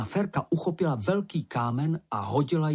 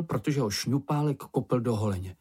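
ordinary delivery

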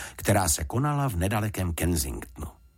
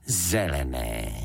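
slow, lower-voiced echo; puzzled father; 4 semitones lower, much slower than usual. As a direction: repeat it slowly, taken aback. Father repeats daughter's word, slowly. (pomalý, nižší otcovský hlas)

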